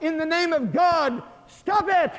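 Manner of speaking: very high, loud cry; calling out. Acted. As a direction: shout it out to stop the fight. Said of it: "Telemachus called out".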